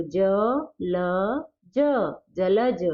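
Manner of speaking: ज, then जलज, each sound drawn out; slow syllable-by-syllable reading; sounded out slowly. Shown as a demonstration. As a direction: spell it slowly before the whole word. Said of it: "sounding out letters to teach".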